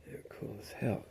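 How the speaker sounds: breathy unvoiced aside; whispered. Real, not acted. Praising the AA guys in a whisper to listeners.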